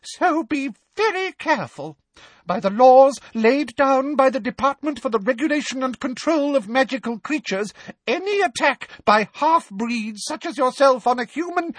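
very high, frightened threat; cowering plea. Acted. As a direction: threaten shrilly through fear. Umbridge's held high voice.

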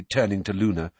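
plain reading voice

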